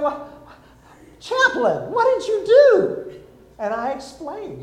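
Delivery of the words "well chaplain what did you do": much higher, louder, slow amazed question; amazed staff. Retold or imitated. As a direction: ask in disbelief. Staff amazed asking chaplain.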